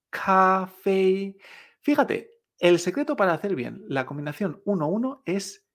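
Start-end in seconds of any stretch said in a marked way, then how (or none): 0.1-1.3 CAFÉ en dos notas iguales, planas y largas; café en chino. Shown as a demonstration. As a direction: alarga CAFÉ sin mover el tono. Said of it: "lo decimos de esta manera". («café» alto, plano y sostenido)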